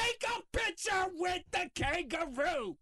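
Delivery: high, slow imitation of a pushy parent; mock insistent parent. Parents urging terrified kids into a photo, mock insistent parent. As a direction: draw it out high, like a parent giving orders.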